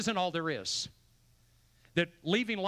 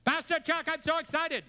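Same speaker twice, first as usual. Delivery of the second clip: much higher, eager and bubbling over; excited Pastor Yuan; 11 semitones higher, 3 dB louder than usual. Retold or imitated. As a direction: squeal it like an overexcited friend. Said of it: "that's how he talks".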